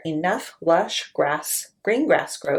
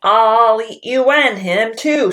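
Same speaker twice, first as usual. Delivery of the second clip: loud hungry troll roar; 4 semitones higher, 7 dB louder than usual. Acted. Troll roaring he'll eat both goats. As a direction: roar it, starving.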